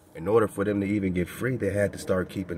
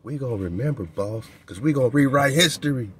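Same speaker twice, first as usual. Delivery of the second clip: louder, a sly taunting hijacker voice, building to a threat; mocking hijacker; 3 semitones higher, 6 dB louder than usual. The hijacker sneers back at the welcomers, louder mock threat. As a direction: sneer it like the hijacker promising revenge. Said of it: "They said".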